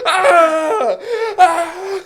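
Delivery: high, loud hammed-up wailing scream; two drawn-out comic shrieks; mock terrified scream.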